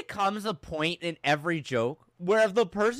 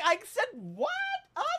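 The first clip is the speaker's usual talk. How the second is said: mock innocent squeal; 11 semitones higher, 4 dB quieter than usual. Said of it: "If your voice goes up six octaves".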